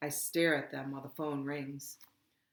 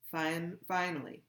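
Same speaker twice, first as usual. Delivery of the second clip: usual speaking voice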